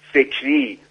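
ordinary talk

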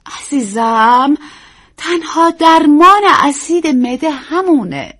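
high and slow sweet insisting; stagey insistence. Acted. Actress justifies drink to hostess, stagey sweetness. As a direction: drawl a sweet excuse.

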